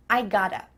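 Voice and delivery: GOTTA as one word ending in "uh"; casual linked "gotta"; reduced gotta. Shown as a demonstration. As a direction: squash "got to" into "gotta".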